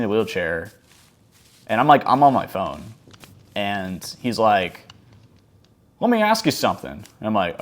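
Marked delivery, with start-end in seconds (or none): 6.0-6.9 put-on pitched-up cranky old guy, a touch bossy; grumpy old man. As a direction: imitate a snippy old man in a wheelchair. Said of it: "He had a real bitch attitude too".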